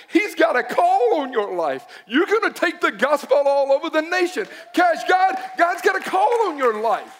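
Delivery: high, impassioned preaching proclamation; acted fervent prophecy. Praying woman tells patient of God's calling, fervent proclamation. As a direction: imitate her declaring a calling with rising fervor.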